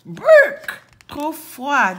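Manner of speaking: high, loud burst of disgust. Acted. Boucle d'Or rejects the cold porridge, disgusted. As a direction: spit out a high, disgusted exclamation. Tasting porridge, throws bowl away.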